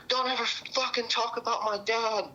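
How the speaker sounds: high mock-furious threat; retelling her angry warning in a high put-on voice; imitating her snap. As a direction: voice the drunk girlfriend lashing out. Drunk girlfriend's outburst, retold.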